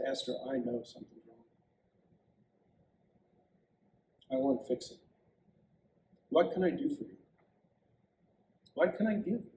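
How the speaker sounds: soft, quiet, gentle king; tender. Acted. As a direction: speak softly to your wife. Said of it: "looked at Esther very tenderly".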